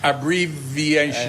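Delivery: ABBREVIATION said slowly, syllable by syllable; slowly sounded out. Shown as a demonstration. Teaching a new English word to the guest, slowly sounded out. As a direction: draw out "Abbreviation" in careful, separate parts.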